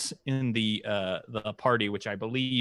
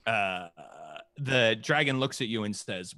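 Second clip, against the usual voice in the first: usual speaking voice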